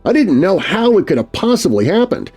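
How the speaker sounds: ordinary delivery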